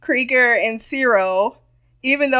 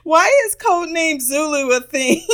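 real laughter through the question; giggling question; laughing; 8 semitones higher than usual. Real, not acted. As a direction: asks while cracking up. Laughing at the show.

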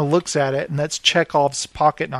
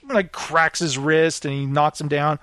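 usual speaking voice

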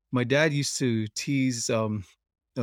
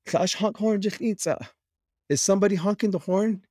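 higher-pitched imitation of Dad, puzzled; retold dad's question; 8 semitones higher than usual. Dad asks his laughing passengers who is honking, imitated in a raised pitch. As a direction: put on Dad's voice, pitched well up. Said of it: "he turns to us, and he says".

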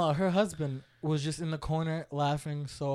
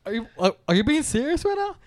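higher, louder stammering disbelief; incredulous sister; 9 semitones higher, 5 dB louder than usual. Retold or imitated. Brother imitating his sister doubting he is serious, incredulous. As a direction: stumble into an astonished question. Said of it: "And then she went".

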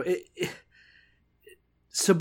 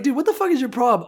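mock-exasperated, quick and higher; exasperated Abner; 7 semitones higher, faster than usual. Podcaster voices Abner challenging his accuser, exasperated. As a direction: fire it off in comic disbelief.

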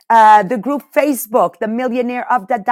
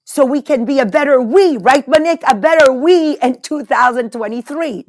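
louder and higher, pumping up the crowd; rallying cheer; 5 semitones higher, 8 dB louder than usual. Real, not acted. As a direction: rouse the listeners like a cheerleader. Rallying the audience.

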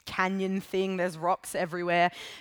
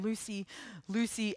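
softer distant call of a name; the voice calling her once more; 7 dB quieter than usual. A narrator's reading. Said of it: "it calls again".

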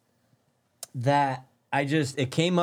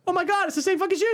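very high, fast squeal of disbelief; amazed squeal; faster than usual. Real, not acted. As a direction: squeal it out, astonished. Amazed at recognizing the shoe.

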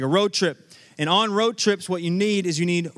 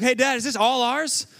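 higher-pitched imitation of a thrilled son; excited kid; 6 semitones higher than usual. Dad quoting his son on seeing the hotel room, excited. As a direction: ask it like an amazed, eager child. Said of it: "He's excited about the hotel".

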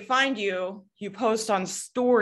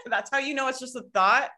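normal talking voice

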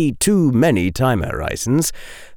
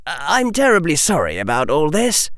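higher-pitched, courteous apology; polite apology; 6 semitones higher than usual. A narrator's reading. Harry apologising to the old witch, polite and higher-pitched. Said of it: "this polite statement".

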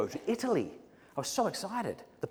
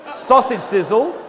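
announcing from stage; 7 semitones higher, 9 dB louder, slower than usual. Retold or imitated. I did that.

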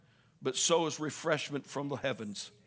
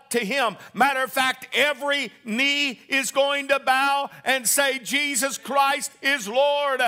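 high, loud preacher's climb, building fervor; roaring revival preaching; full-force preaching; 8 semitones higher, 7 dB louder than usual. Acted. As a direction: preach it to the rafters.

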